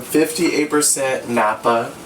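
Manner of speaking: ordinary talk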